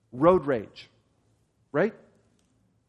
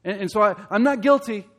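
high, loud, fast protest of innocence; self-justifying listener; 7 semitones higher, 7 dB louder, faster than usual. Retold or imitated. Preacher voicing a listener claiming innocence, loud and fast. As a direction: blurt it out defensively, quick and high. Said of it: "You said".

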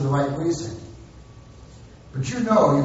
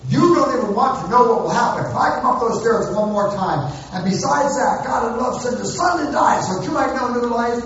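mock hollered threat; 6 semitones higher than usual. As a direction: yell it up the stairs, then tack on the gospel. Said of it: "when I'm hollering threats".

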